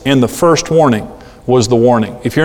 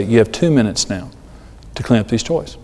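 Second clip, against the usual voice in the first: lower, softer controlled warning; 4 semitones lower, 6 dB quieter than usual. Shown as a demonstration. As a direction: tell the children calmly, without yelling. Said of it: "just in a low tone of voice".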